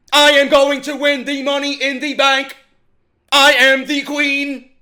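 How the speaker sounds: loud robotic monotone held on one high note; mock a lifeless promo, every word at the same pitch; Charlotte. Wrestling reviewer mocks Charlotte's emotionless promo, robotic monotone. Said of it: "It's very monotone".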